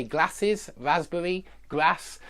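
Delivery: glasses, raspberry, grass with a flat a as in cat; northern English flat a; northern short a. Shown as a demonstration. Teacher contrasting the northern English vowel. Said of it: "But they say".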